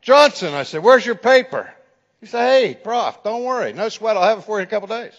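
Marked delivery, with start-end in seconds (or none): 0.0-1.7 loud, higher demand for the paper; stern call-out. Retold or imitated. Professor demanding a late term paper, loud and stern. 2.5-5.1 breezy, unbothered student reply; casual. Retold or imitated. Careless student brushing off the professor, breezy. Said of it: "they're as casual as they can be".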